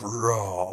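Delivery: growled mock roar; imitated beast roar. Beast echoing the halls, an imitated roar. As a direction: imitate a monster roaring. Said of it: "like, that sort of thing".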